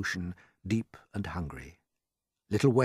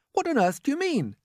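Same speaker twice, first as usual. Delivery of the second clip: cried out; 4 dB louder than usual. A narrator's reading. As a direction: echo it back in alarm. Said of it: "they cried".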